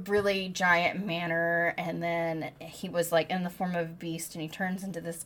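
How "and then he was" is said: ordinary delivery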